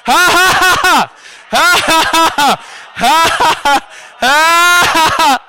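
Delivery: repeated bursts of "ha ha ha"; forced hearty laugh. Shown as a demonstration. Preacher modelling laughing at the situation, forced bursts. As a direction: laugh hard in rhythmic bursts. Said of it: "Laugh at that situation".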